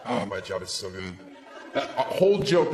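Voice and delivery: ordinary delivery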